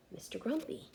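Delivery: soft unvoiced whisper; hushed aside to the little listeners. Real, not acted. Reader whispers to the children.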